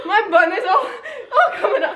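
high giggly words; laughing. Real, not acted. Wife laughs about her bun after prank, giggling. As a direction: talk through real giggles.

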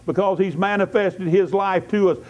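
normal talking voice

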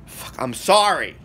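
usual speaking voice

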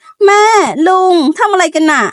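high shocked cry at mother and uncle; shocked shriek. A narrator's reading. Daughter walking in on mother and uncle, shocked high cry. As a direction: catch them in the act and shriek. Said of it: "ไหมกรี๊ด".

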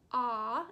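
a loud, held "ah" vowel said alone; demo ah sound. Shown as a demonstration. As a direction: call out the "ah" sound clearly for the class. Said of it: "you're gonna hear the ah sound".

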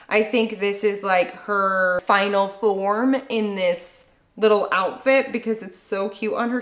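usual speaking voice

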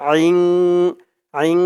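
guttural ain sound; throaty ain. Shown as a demonstration. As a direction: push the আইন from the throat. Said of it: "উচ্চারণ করতে হবে আইন".

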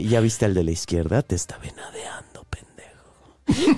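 low unvoiced whisper, last word dragged very slow; menacing whisper. Warning the caller about a ghost, in a low unvoiced whisper. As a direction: breathe the threat into the phone. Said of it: "yo era una gran sacerdotisa".

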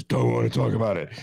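loud, brusque refusal in a put-on voice; Bernie Sanders. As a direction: bluntly shut the question down, loudly. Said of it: "and he says".

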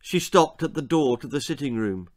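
ordinary delivery, nothing marked

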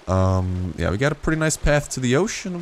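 usual speaking voice

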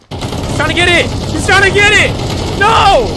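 very high, loud frantic yelling; excited yelling. Real, not acted. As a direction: scream through a clutch moment. Clutch fight, yelling.

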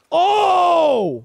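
loud, high, drawn-out "oh"; startled cry. Real, not acted. Player caught by surprise in a firefight, startled cry.

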